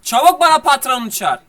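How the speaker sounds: angry demand. Acted. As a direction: demand the boss, outraged. Furious customer demands the boss.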